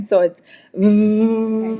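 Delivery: v buzzed long and slow; V sound. Shown as a demonstration. Teacher demonstrating the V to a student, slow and buzzing. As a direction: let the v vibrate on the lip. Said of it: "feel a vibration".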